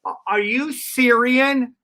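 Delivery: high, slow, drawn-out question; Mitzi. Retold or imitated. Club owner questioning a new comic, slow high imitation. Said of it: "She goes".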